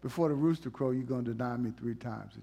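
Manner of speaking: a touch of a gentle Jesus. Retold or imitated. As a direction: say it kindly, not angry. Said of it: "he wasn't angry with him".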